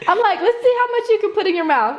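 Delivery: high, giggling through the line; laughing. Real, not acted. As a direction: say it while cracking up. Laughing over food challenge.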